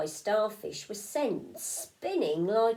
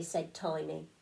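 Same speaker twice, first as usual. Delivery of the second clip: plain reading voice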